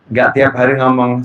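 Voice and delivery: ordinary talk, nothing marked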